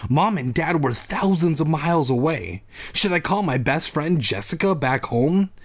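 normal talking voice

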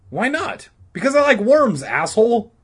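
put-on high, loud kid squabble; shrieky schoolyard comeback; bickering. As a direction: snap back like an annoyed boy. Two boys bickering.

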